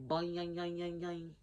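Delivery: playful boing yang sound effect; boomerang noise. Retold or imitated. Showing viewers what she hears, a boomerang boing. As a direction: mimic a boomerang flying back. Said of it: "I heard boomerang".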